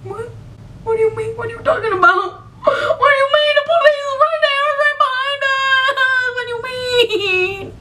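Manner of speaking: can't believe the police are not there; panicked disbelief. Retold or imitated. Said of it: "And I'm like".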